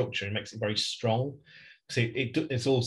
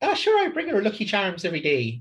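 mock stage Irish; 9 semitones higher, 3 dB louder than usual. Mocking stereotyped Irish talk.

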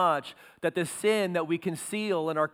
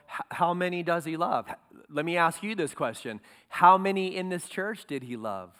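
usual speaking voice

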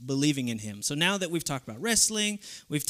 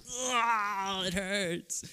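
mock agony; 4 semitones higher, 5 dB quieter, much slower than usual. Wrestler faking agony.

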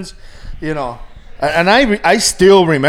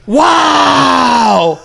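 mock amazed wow; 6 semitones higher than usual. Patient retelling his drugged amazement, mock awed. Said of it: "when I said that, like, all the nurses were cracking up".

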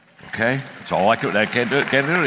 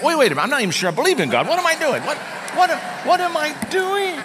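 high, comic bewildered voice; mock alarm, rising higher and higher; startled disbelief. Agnostic colleague catching himself thanking God, comically bewildered.

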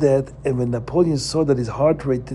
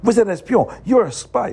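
a quick, sharp, higher Vous êtes un espion; quick accusing snap in French; said quickly; 9 semitones higher than usual. Shown as a demonstration. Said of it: "And he said it quickly".

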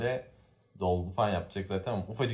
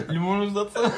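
much higher, louder, through laughter; laughing squeaky quote; 13 semitones higher, 8 dB louder than usual. Pretend. Friend imitating lahmacun eaters, squealing with laughter. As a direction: squeal the line while cracking up.

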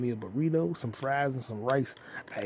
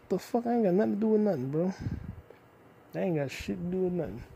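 much higher, sudden indignant squawk; startled outburst; 6 semitones higher, faster than usual. Real, not acted. Vlogger startled by a browser popup, high indignant squawk. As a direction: jump at it, high and offended.

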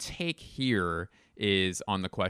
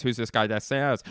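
movie crew impression. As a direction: repeat it back in a near-normal voice. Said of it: "here's my impression of".

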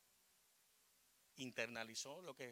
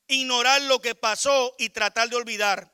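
slow, very loud, higher preaching; full-force preaching; 7 semitones higher, 20 dB louder, slower than usual. Acted. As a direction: thunder each point from the pulpit, slowly. (predicación a todo pulmón, lenta)

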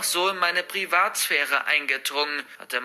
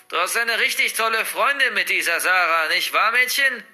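higher, louder, sickly sweet taunting; disgusting purr; 5 semitones higher, 5 dB louder than usual. Acted. Grandmother baiting the girl about Sarah, nasty sweet purr. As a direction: coo it nastily, like bait. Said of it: "ein ekelhaftes Säuseln in der Stimme".

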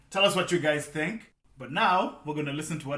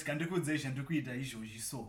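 put-on Shonglish accent; 7 dB quieter than usual. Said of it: "I'll do Shonglish".

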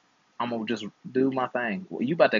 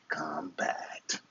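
whispered aside; 7 semitones lower, 12 dB quieter than usual. Acted. Teasing aside about gymnasts.

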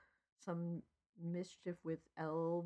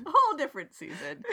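fast, loud, higher echo through laughter; laughing; 9 semitones higher, 11 dB louder, faster than usual. Real, not acted. Echoing her co-host's joke, laughing hard. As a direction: giggle the words out.

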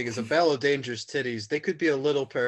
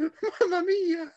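put-on Italian accent; 4 dB louder, slower than usual. Italian Quebecer cries out.

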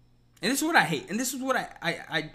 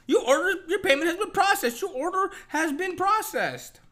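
high robotic announcer voice; app voice; 11 semitones higher than usual. Retold or imitated. Mimicking the delivery app's confirmation, a high robot voice. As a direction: play the automated order confirmation, high and mechanical.

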